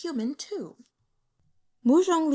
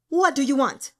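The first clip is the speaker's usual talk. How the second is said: irritable snap, a bit louder and higher; snapping; 4 semitones higher, 6 dB louder than usual. A narrator's reading. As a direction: bite the attendant's head off. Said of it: "Mu Zhonglian snapped".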